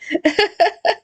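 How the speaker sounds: short high laugh at her own joke; burst of laughter. Real, not acted. Laughing at her own word mother ducking.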